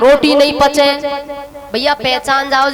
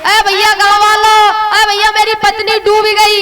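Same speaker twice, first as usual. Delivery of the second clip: higher, louder cry for help; desperate call for help; 6 semitones higher, 4 dB louder than usual. Acted. Husband shouting to villagers for help, desperate. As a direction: shout to the villagers that your wife drowned.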